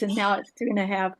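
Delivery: usual speaking voice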